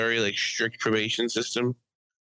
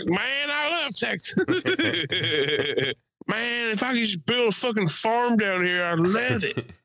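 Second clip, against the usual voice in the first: fake Texas accent; 9 semitones higher than usual. Mocking tourists who get drunk and fake a Texas accent. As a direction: ham up a drunk Texan dreaming of a farm. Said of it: "fake a Texas accent".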